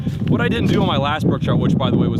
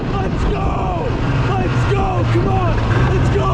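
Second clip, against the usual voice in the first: ecstatic yelling; 3 semitones higher, 4 dB louder, much slower than usual. Real, not acted. Celebrating the catch alone.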